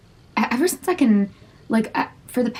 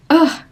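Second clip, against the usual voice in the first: louder, higher groan of frustration; exasperated groan; 7 semitones higher, 7 dB louder than usual. Real, not acted. Podcaster tired of struggling with herself, exasperated groan. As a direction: let out a frustrated "ugh".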